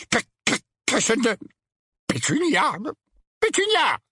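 high, halting stammer; hoarse voice. A narrator's reading. Uncle Vernon calling to his silent wife, hoarse voice. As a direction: stumble over the words in a high, rattled voice. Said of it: "d'une voix rauque".

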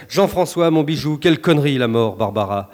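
ordinary talk

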